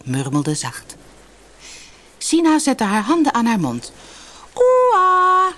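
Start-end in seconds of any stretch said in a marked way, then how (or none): 4.5-5.5 high, loud, long-held hail; calling out. A narrator's reading. Sina hails the island's men, calling loud and high. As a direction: holler it far across the beach. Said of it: "riep ze".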